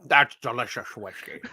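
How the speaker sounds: low, soft Scottish film-star impression; Sean Connery. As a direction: relish it with a deep Scottish burr. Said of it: "Sound like Sean Connery".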